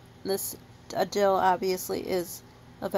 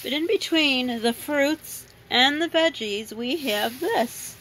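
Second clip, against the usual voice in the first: normal talking voice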